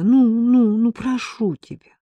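high, drawn-out begging; pleading. A narrator's reading. Ella implores her friend to deliver the pin, pleading. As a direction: wheedle and implore. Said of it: "упрашивала Элла".

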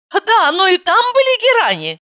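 high, eager reply; light up with the idea; perking up. A narrator's reading. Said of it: "оживилась я".

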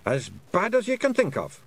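high Scottish pictsie warning. A narrator's reading. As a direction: pitch the grim warning up high. Feegle answers about monsters.

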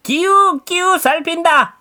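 bright call of 기웃기웃; lively farmer voice. Acted. Farmer says, grandpa repeats.

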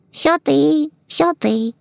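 high, small mouse voice; tiny embarrassed mice; faint squeaking. A narrator's reading. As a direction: squeak sheepishly, barely piping up. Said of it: "чуть слышно пропищали мышата".

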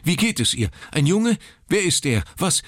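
high, urgent, tumbling questions; nervous father begging for news; frantic questions. Acted. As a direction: pepper him with anxious questions.

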